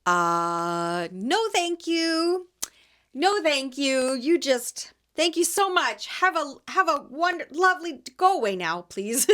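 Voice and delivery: high, slow, flustered politeness; thrown off. Retold or imitated. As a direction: brush them off sweetly while rattled. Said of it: "so thrown off by the whole thing".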